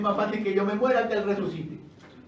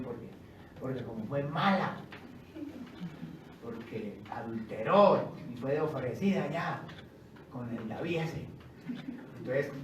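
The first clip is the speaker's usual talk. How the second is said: judgmental gossip; 6 semitones lower, 5 dB quieter than usual. Retold or imitated. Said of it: "uno dice".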